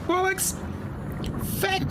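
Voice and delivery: very high frantic squeal; panicked squeal. Real, not acted. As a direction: shriek it in a panic. Pressed wrong key, panicking.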